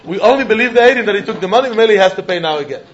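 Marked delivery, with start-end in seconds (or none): none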